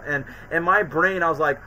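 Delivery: ordinary talk, nothing marked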